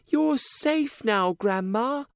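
put-on high, slow gentle girl; reassuring. A narrator's reading. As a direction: soothe grandma tenderly. Girl comforts grandma.

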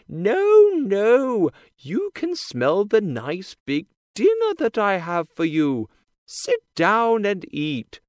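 high, loud woman's voice; big woman's voice. A narrator's reading. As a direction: call it out high and loud as the giant's wife. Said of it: "says the woman".

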